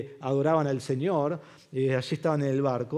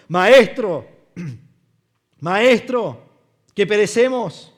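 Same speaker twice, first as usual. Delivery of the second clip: grito desesperado; 3 semitones higher, 8 dB louder, slower than usual. Acted. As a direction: shout it in terror. Discípulos gritan en la tormenta. (grito aterrado de los discípulos)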